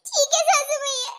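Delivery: loud, high tearful surrender breaking into sobs; hurt daughter-in-law crying; bursting into tears. Acted. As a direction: give in, then sob.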